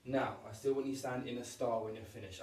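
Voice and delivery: ordinary talk, nothing marked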